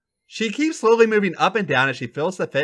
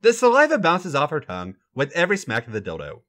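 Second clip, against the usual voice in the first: ordinary delivery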